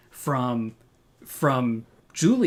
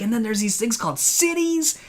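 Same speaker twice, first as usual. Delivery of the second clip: higher-pitched imitation of an eager learner; light, higher voice acting out wonder; mock eagerness; 9 semitones higher than usual. Host imitating Luca telling of cities, mock eagerness.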